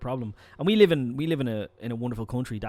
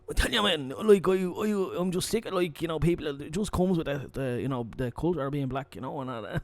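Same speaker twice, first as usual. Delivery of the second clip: put-on higher-pitched young lad's Dublin accent; thick Dublin accent; 3 semitones higher than usual. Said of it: "still got a little bit of Nigeria, but he's all Dublin".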